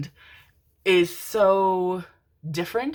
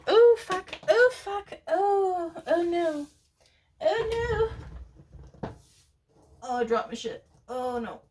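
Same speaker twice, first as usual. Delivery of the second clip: small, very high whimpering voice; high-pitched lament; 13 semitones higher, slower than usual. Real, not acted. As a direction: squeak out your dismay over a dropped thing. Drops something, laments in tiny voice.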